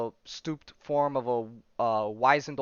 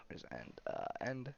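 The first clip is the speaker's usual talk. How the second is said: whispered grumble; 3 semitones lower, 17 dB quieter, faster than usual. Real, not acted. Muttering while searching image.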